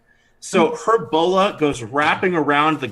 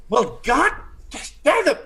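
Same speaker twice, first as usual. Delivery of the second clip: high exasperated lady's voice; irritated lady; 11 semitones higher than usual. Acted. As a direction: sputter in annoyance.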